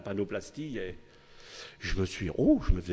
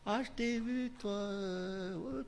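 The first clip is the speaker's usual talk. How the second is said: higher, softer mock accusation from a servant girl; accusing servant girl; 11 semitones higher, 7 dB quieter, slower than usual. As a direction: catch him out, high and sly. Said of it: "la petite servante là qui dit".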